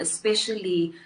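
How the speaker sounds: ordinary delivery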